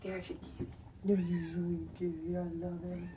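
soft, low murmur to a cat; tender cooing. Real, not acted. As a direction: soothe the kitty softly. Cuddling and soothing the cat.

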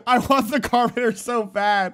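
normal talking voice

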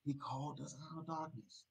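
ordinary delivery

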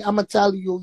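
ordinary talk, nothing marked

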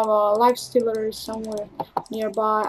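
usual speaking voice

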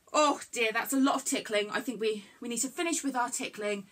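ordinary talk, nothing marked

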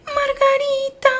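very high, slow, melting squeal; overcome squeal. Real, not acted. Player overcome by a pet's outfit, drawn-out squeal. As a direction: draw it out as if melting from cuteness.

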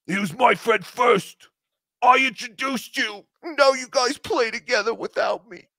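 put-on high, whiny jealous girlfriend voice. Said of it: "jealous girlfriend".

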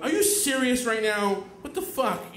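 high, loud mock outrage; outraged. To the friend who spilled beer on him, outraged. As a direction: exclaim it in incredulous retold anger. Beer poured on his shirt.

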